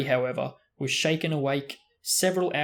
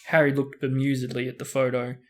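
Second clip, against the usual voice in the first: ordinary delivery, nothing marked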